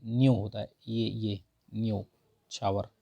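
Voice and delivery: न्योछावर drawn out with न्यो stressed; slow, careful न्योछावर. Shown as a demonstration. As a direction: lean on न्यो in न्योछावर slowly. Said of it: "न्योछावर नहीं होता है".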